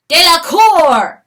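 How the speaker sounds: slow loud commanding call; shouted. Acted. Stag calling his fawn, slow loud shout. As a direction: boom the name out slowly. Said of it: "shouted the stag".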